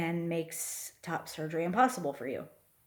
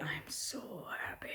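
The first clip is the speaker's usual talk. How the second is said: whispered to herself, overjoyed; a soft whispered burst of joy; happy whisper; 2 semitones lower, 11 dB quieter than usual. Real, not acted. Overjoyed aside after transition news.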